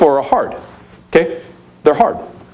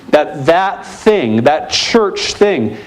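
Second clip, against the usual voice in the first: slow emphasis; much slower than usual. Real, not acted. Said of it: "slowed emphasis".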